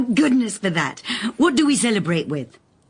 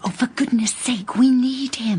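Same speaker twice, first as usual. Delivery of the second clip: whisper; 4 semitones higher than usual. Acted. Urgent aside between colleagues.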